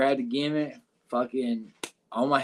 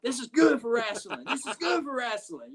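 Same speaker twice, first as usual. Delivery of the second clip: hyped promoter dad; 9 semitones higher than usual. Retold or imitated. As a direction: sell it like a promoter smelling money. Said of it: "he was like".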